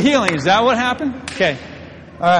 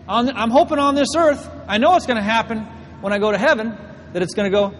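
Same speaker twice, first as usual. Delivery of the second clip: ordinary talk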